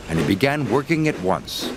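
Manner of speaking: plain reading voice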